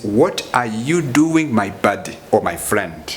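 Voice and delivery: slow, careful "What are you doing"; formal teacher model; slow formal version. Shown as a demonstration. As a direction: say it properly and slowly. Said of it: "In a very good and formal way".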